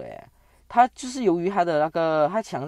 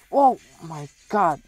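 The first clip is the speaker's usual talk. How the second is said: surprised gasp; 4 semitones higher, 4 dB louder, slower than usual. Real, not acted. Enemy general ambushes from stealth.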